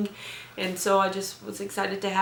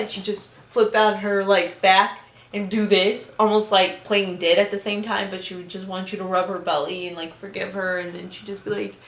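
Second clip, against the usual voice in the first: normal talking voice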